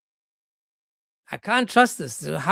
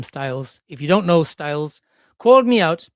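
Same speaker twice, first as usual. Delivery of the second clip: usual speaking voice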